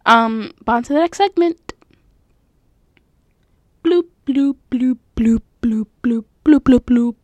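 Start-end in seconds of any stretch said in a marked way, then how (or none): 3.8-7.1 whispered water-drip bloops; dripping water sounds. Pretend. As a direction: bloop like dripping water under your breath. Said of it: "this is Taking a Stroll".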